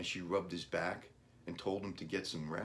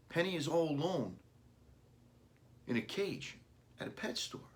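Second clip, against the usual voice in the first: put-on much higher child's voice, fading at the end; worried boy; 10 semitones higher than usual. A narrator's reading. Boy telling his dad about Penny, high and anxious. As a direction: plead like a small anxious kid.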